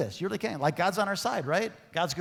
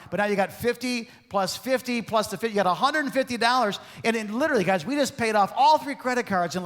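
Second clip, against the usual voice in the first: higher, louder, fast rallying sermon push; fired-up preaching; 7 semitones higher, 6 dB louder than usual. Acted. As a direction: preach it at full force to the crowd. Preacher drives home debt plan.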